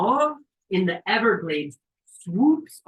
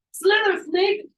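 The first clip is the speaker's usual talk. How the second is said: even higher, loud cheer; renewed urging shout; 9 dB louder, slower than usual. Retold or imitated. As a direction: scream it louder for the snake. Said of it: "like, like".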